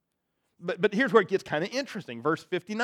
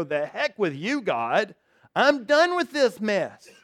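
fed-up giving up; 4 semitones higher, slower than usual. Acted. Voicing a fed-up believer quitting.

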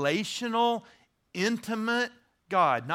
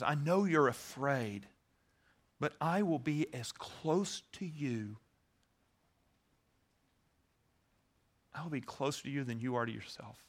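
tender voice of God; 4 semitones lower, 7 dB quieter than usual. Acted. Preacher voicing God's tender promise to the afraid, softly. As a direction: speak God's promise close and gently.

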